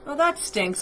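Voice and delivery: plain reading voice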